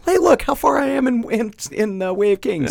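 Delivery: high-pitched, pleased mock brag; proud boast. Reader bragging about progress through the book, proud high voice. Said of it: "I was very proud of myself".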